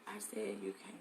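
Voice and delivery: 속삭이듯 작게 말하기; whispered aside off camera. Real, not acted.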